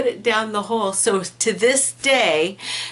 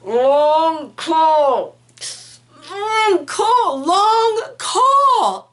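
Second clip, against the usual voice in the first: imitating a desperate man, pitch climbing much higher, strained; through gritted teeth; 9 semitones higher, 5 dB louder, much slower than usual. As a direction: squeeze out "long call" through clenched teeth, rising in panic. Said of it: "Jose was gritting his teeth saying".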